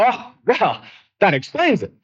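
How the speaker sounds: high-pitched, feigned understanding; mock realization. Mock acceptance of the waiter's excuse.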